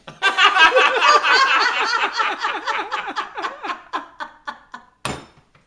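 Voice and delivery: long, loud, high belly laugh; uproarious laugh. Real, not acted. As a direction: burst out laughing at your own punchline. Laughs at her own punchline.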